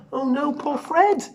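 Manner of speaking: ordinary delivery, nothing marked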